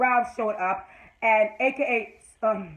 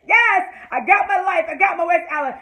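higher, louder happy shout; joyful cheer; 8 semitones higher, 7 dB louder than usual. Retold or imitated. Fan cheering her couple's kiss, excited. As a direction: celebrate out loud. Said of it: "I was like".

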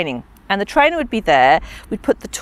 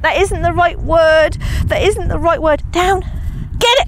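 dog's thoughts; 7 semitones higher than usual. Retold or imitated. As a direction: play the puzzled dog muttering to itself. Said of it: "She went".

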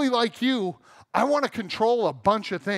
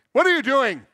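mock-irritated dad barking at the kids, loud and higher; raised, testy voice in playful re-enactment; barky dad; 4 semitones higher, 6 dB louder than usual. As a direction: bark it like a cranky father. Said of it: "Dad gets a little barky".

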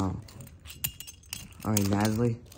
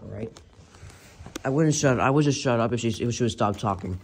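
normal talking voice

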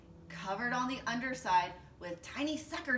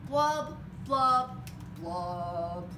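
blub, blub, blub sinking lower each time; gloomy fish blubs; 5 semitones lower, 5 dB louder, much slower than usual. Acted. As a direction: let each blub drop deeper, glum. Fish's refrain, sinking gloomy blubs.

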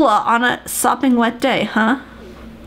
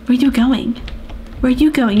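ordinary talk

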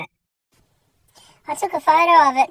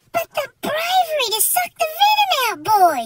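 mock awe; 11 semitones higher than usual. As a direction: hail her like a war hero. Mock-heroic praise of Nan.

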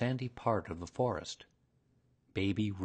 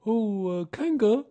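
Pooh voice; 12 semitones higher, 7 dB louder than usual. A narrator's reading. Pooh starts hesitantly.

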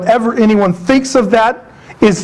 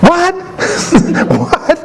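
mock startled hearing; 8 semitones higher than usual. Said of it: "So it's be like".

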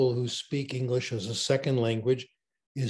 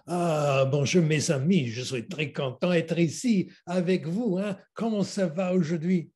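hammed-up, higher-pitched French greeting; exaggerated French; 5 semitones higher than usual. Student mocking examiners in a French oral exam, exaggerated French. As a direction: play up the French, mock-theatrical. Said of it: "fully exaggerated".

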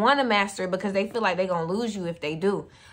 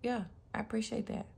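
softer, fast, relaxed thanks; calm quiet reply; 4 semitones higher, 8 dB quieter than usual. Retold or imitated. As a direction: brush it off gently, unbothered. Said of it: "you gonna be like".

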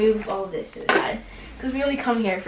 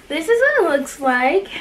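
higher and louder, thrilled; proud excited unveiling of the laptop; excited reveal; 5 semitones higher, 4 dB louder than usual. Real, not acted. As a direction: show it off with delight. It's so beautiful, showing laptop.

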